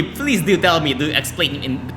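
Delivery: ordinary talk, nothing marked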